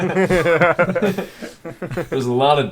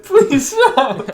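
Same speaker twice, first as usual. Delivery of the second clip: high, loud begging through laughter; laughing plea; 11 semitones higher, 5 dB louder than usual. Real, not acted. Teased friend begging the others, high laughing plea. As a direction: beg them to quit while laughing.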